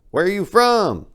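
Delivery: high, loud call across the bar; shouting owner. Retold or imitated. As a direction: yell the question across the room. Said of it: "the owner shouts out".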